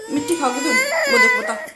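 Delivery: high whiny fake-crying scold. Real, not acted. Mother scolding her baby for eating soil, in mock wailing.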